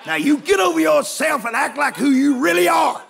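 loud, laughing rebuke; preaching shout. Acted. Preacher rebuking the congregation, loud and laughing. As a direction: let it ring out from the pulpit.

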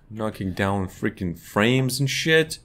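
ordinary talk, nothing marked

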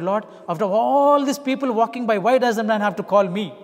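loud, high angry complaint to God; cursing God. Retold or imitated. Preacher retelling how he cursed God, loud and high. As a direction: shout your frustration up at heaven. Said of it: "And I was cursing God".

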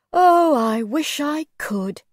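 high, slow, drawn-out lament; wistful regret. A narrator's reading. Old man wishes he could walk, wistful. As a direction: sigh out the wish, stretching the first word.

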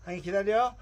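loud stern wait order; firm dog commands. Real, not acted.

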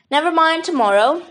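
ordinary delivery

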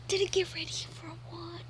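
muttered whisper aside. Real, not acted. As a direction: whisper it to yourself. Mutters to himself.

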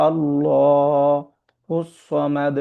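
আল্লাহু with a heavy full lam, very slow chant; full-mouth recitation. Shown as a demonstration. Teacher demonstrating the heavy lam in recitation. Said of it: "মুখটাকে ভর্তি করে পড়ব".

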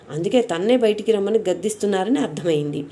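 ordinary delivery, nothing marked

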